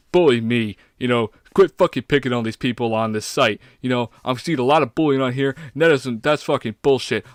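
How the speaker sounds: loud, forceful TikTok-style tirade; mock rant. Guest showing what an anti-bullying rant video sounds like, mock rant. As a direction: take on the bullies in a loud parody rant. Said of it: "I guess it's something like".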